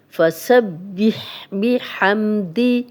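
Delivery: فسبح بحمدی slowly with a clear ح and a rise; slow melodic recitation; tajweed recitation. Shown as a demonstration. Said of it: "ہا کی clear آواز آنی چاہیے".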